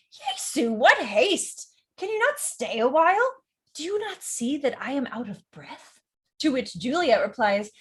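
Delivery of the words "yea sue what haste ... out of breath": high-pitched theatrical protest; complaining nurse. Acted. Nurse protests to Juliet about haste, theatrical complaining. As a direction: scold with hammed-up exasperation. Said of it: "the nurse says".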